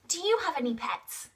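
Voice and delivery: ordinary delivery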